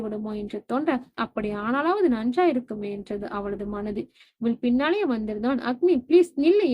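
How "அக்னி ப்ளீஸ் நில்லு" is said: louder, higher call to stop her; urgent raised plea to wait; calling after her. Acted. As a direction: call out for her to stop as she walks away. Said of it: "அவள் பின்னாலே வந்து".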